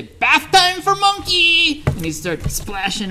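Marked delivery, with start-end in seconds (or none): 0.0-1.8 very high, loud and slow monkey squeal; a gleeful monkey diving into the river; monkey shouting bath time. Acted. Storyteller's monkey jumps into the river, high and loud. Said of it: "and say".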